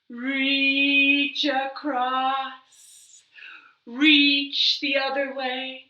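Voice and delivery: slow, encouraging stretch leader; guided stretch. Acted. Librarian guiding children through stretches, slow and encouraging. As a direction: lead the kids gently through it.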